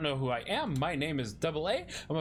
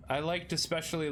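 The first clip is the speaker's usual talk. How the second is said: ordinary delivery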